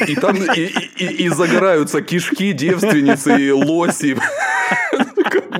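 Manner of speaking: laughing. Real, not acted. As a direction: blurt it out through laughter. Laughing absurd punchline.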